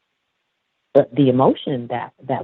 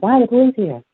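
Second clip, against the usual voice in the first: put-on higher-pitched child's question; questioning little boy; 8 semitones higher, 4 dB louder, faster than usual. Little boy asking on the way back through, high questioning voice.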